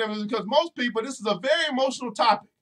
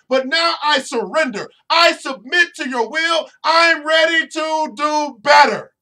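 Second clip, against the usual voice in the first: louder, building cry of surrender to God; a praying believer crying out, then shouting his surrender; impassioned prayer; 5 dB louder than usual. Acted. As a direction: plead and surrender, rising to a shout.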